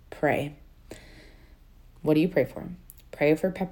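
ordinary delivery

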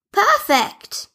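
high worried call for a lost dog; Barbie Leah calling for Sprinkles. Acted. Leah character voice.

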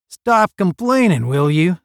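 loud, high, irritated outburst; snarling. A narrator's reading. Cadet fed up with roommates' complaints, snarling. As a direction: snap at them to quit moaning. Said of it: "snarled Roger".

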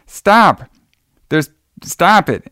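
high, loud "stop" with a slow second stop; hammed-up panicked girl calling out; imitating the girl on water skis yelling stop; mock yell. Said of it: "She's like".

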